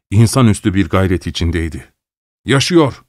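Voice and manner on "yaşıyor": crying out. A narrator's reading. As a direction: shout out in relief. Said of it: "diye haykırdım". (sevinçle haykırış)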